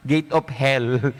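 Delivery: slow, chuckling through the words; laughing. Acted. Priest telling the joke, laughing at the punchline. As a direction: let the laugh spill into the words.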